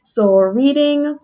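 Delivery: normal talking voice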